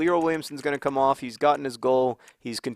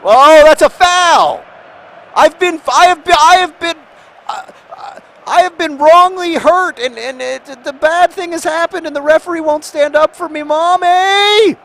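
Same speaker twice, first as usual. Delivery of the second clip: high, very loud wail of protest; loud, high, stammering tantrum; angry outburst; 13 dB louder than usual. Real, not acted. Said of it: "Sorry, I'm angry".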